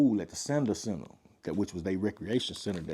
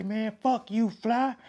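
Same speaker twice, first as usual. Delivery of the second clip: much higher, defiant "fuck you"; taunting crowd voice; 11 semitones higher, slower than usual. Retold or imitated. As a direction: throw an insult from the crowd. Someone in crowd insults Fly.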